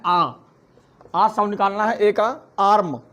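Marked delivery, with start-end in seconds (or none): 0.1-0.3 आ said as a long open aa vowel; teaching the aa sound. Shown as a demonstration. Teacher demonstrates the fourth phonic sound आ. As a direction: draw out आ for the class. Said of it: "आ निकालना है".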